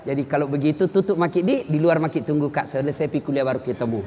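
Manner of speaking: calm firm classroom exchange; gentle lecturer. Shown as a demonstration. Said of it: "Tidak perlu kita maki-maki". (dosen dan mahasiswa berbicara pelan dan santun)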